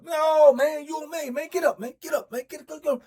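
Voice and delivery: loud, high commands, get up; imitating a furious cellmate; angry shouting. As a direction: bark him off the bed. Rip ordering celly off the bed.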